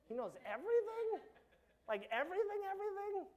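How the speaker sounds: high, loud incredulous "everything?"; squeaky mock-horrified question; mock disbelief. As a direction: act out shocked disbelief. Mock shocked reaction to God knowing.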